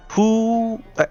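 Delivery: usual speaking voice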